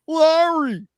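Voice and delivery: high, squeaky exec voice, drawn out; high cartoonish impression of a GM; mock Trent Baalke. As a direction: ham up a squeaky general manager. Baalke voice dialogue.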